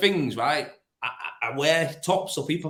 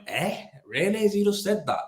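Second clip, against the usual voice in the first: retold disbelief, rising higher; incredulous; 6 semitones higher than usual. [name] recalls his reaction to a co-worker's slur, incredulous. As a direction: question it in mild shock.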